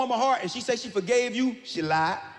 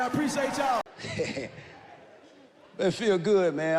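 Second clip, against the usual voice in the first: usual speaking voice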